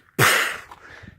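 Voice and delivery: explosive sputter like a gunshot; imitated loud bang. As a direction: burst air out like a speaker exploding. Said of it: "I hear this".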